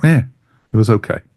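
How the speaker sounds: ordinary talk, nothing marked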